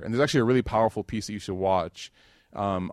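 ordinary delivery

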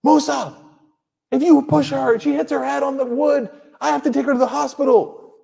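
high, strained mock yell; re-enacted parental yelling. Father telling son off for pushing his sister, mock yelling. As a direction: scold in a high, pushed voice, acted out. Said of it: "when I yelled at Musa".

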